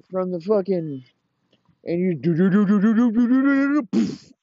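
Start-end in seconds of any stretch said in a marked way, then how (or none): 1.8-4.3 climbing higher and louder with each do; rising bump noises. Retold or imitated. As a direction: mimic a wheelchair bouncing down stairs. Kid bumping down stairs.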